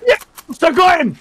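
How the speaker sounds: high-pitched triumphant shout; excited cheer. Real, not acted. Teammate cheering a kill in a shooter, excited shout. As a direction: celebrate the kill out loud.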